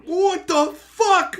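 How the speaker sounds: high, slow, drawn-out what the fuck; loud, slow incredulous cry; stunned disbelief. Retold or imitated. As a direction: stretch it out in disbelief. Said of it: "And I'm just like".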